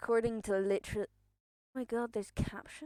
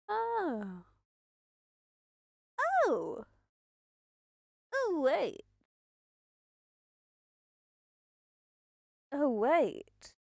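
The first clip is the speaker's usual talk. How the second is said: surprised; 6 semitones higher, much slower than usual. Real, not acted. Sudden realisation about captions.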